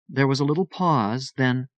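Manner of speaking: ordinary delivery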